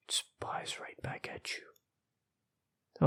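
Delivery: very slow, soft whisper; creepy whisper. A narrator's reading. Narrator revealing eyes in the darkness, creepy whisper. Creature reveal in scary story.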